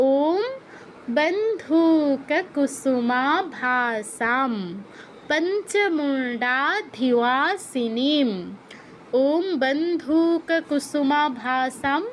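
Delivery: slow Sanskrit recitation for students to copy; slow mantra recitation. Shown as a demonstration. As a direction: chant বন্ধুক Kusuma Vasam slowly, syllable by syllable. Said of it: "এরকম".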